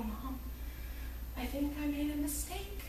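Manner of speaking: small, soft, mock-tearful voice; mock tearful confession. New bride phoning her mother, mock tearful confession. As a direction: confess it softly, as if after crying. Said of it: "called my mother, sobbing".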